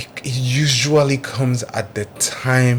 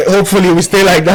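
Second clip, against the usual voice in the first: louder, higher, laughing as you say it; 8 semitones higher, 8 dB louder, faster than usual. Real, not acted. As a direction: laugh through the line. Laughing response.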